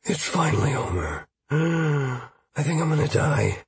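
winded, breathy gasps between phrases; gasping for air. Acted. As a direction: collapse exhausted, sucking in air as you speak. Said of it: "gasping for air".

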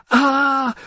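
high drawn-out shriek of panic; prolonged panic scream. Acted. Narrator voicing her frenzied panic scream. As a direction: let out a long frenzied scream. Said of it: "a prolonged".

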